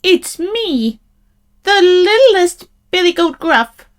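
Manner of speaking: tiny high-pitched goat; little goat voice. A narrator's reading. Littlest goat answering the troll, small high voice. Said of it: "in his little bit of voice".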